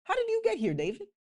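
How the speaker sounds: high, fast mocking brother; Eliab scolding. Older brother Eliab taunting David, high and fast. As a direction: taunt him quickly. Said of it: "That's what he's basically saying".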